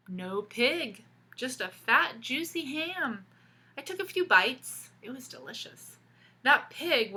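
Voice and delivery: put-on lively read-aloud for kids; storybook reading. A narrator's reading. Teacher reading a picture book to children, animated storytelling. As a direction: tell the story to the class with expression.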